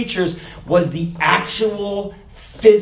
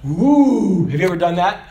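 ordinary talk

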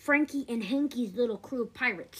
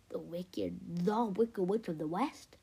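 plain reading voice

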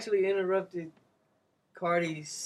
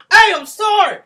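loud, high shout of sorry; shouted apology; 19 dB louder than usual. Retold or imitated. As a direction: yell the apology in her face. Acting out popping up at work.

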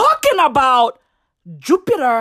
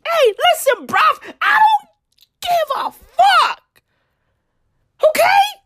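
much higher, yelled, dragging out give a fuck; exasperated shout; 12 semitones higher than usual. Real, not acted. Ranting at stargazers online, exasperated high yell. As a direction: blow up in disbelief. Rant peaks at the crowd.

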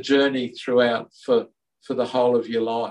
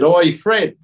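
mock Cockney hail, higher and louder; 4 semitones higher, 6 dB louder than usual. Jesus hailing the prisoner Fred, in Cockney. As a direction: call out like a cheeky Cockney mate. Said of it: "Jesus spoke with a Cockney accent".

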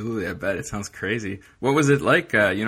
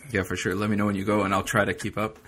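ordinary talk

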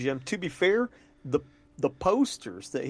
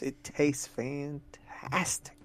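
slow dramatic whisper; 6 dB quieter, much slower than usual. Real, not acted. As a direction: breathe it out slowly, almost reverent. Hyping up the pizza log.

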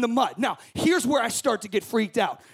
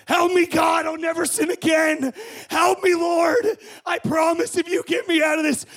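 desperate prayer; 4 semitones higher, 4 dB louder than usual. Shown as a demonstration. Crawling on the ground, loud desperate pleading prayer. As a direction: beg God at full volume while crawling. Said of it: "I'm gonna just demonstrate this".

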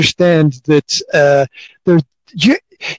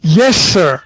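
ordinary talk, nothing marked

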